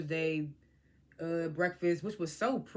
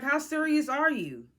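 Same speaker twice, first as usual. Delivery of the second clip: higher, louder disbelieving question; raised-voice incredulity; incredulous challenge; 6 semitones higher, 5 dB louder than usual. Retold or imitated. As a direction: call her out in disbelief. Mock challenge to smirking cast member.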